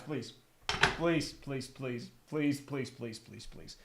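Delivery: pleading that fades to almost nothing; fading pleas. Real, not acted. Fan begging for Rodgers, fading to near silence.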